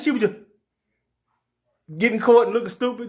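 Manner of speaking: usual speaking voice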